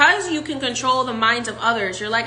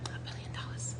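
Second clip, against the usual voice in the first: hushed whisper; 20 dB quieter than usual. Shown as a demonstration. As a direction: breathe it in a whisper. Repeated after plain take.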